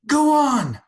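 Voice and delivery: very high, loud yell. A narrator's reading. Mrs. Turpin yelling at God across the pasture, loud. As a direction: hurl the challenge out. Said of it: "she yelled".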